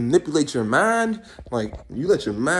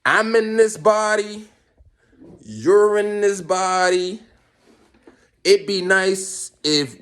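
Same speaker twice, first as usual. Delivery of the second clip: slow, deliberate, emphatic appeal; addressing the brain; 4 semitones higher, 4 dB louder, much slower than usual. Acted. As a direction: spell it out slowly to your own brain. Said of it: "you just asked your brain, like, Hey, brain".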